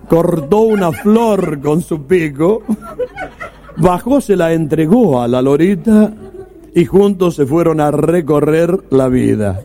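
slow, careful poem recitation; recited poem. Retold or imitated. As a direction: recite it like a proud speech exercise. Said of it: "tres meses intensivos de mentalista".

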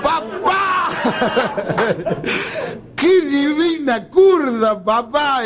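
slow, high, sing-song tipsy drawl; drunk son's slurred praise. Acted. Son teasing his drunk father, slow and high. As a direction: stretch it out like a happy drunk. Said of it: "repeat of son's line".

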